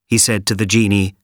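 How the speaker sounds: plain reading voice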